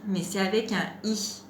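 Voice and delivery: usual speaking voice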